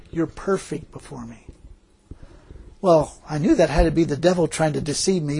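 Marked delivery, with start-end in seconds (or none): 0.1-1.5 soft, understated divine voice; hushed retelling of God's words. Retold or imitated. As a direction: quote God gently, dropping well below speaking level. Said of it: "This voice said".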